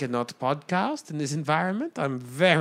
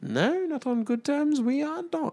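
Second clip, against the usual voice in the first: put-on Australian accent; 8 semitones higher than usual. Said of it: "you're going very Australian there".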